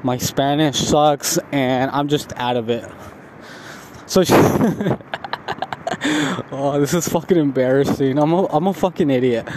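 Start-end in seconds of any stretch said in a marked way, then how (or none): 4.4-6.5 soft, breathy embarrassed giggle; embarrassed laugh. Real, not acted. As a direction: laugh it off, a bit ashamed. Embarrassed about being high.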